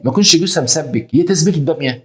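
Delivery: usual speaking voice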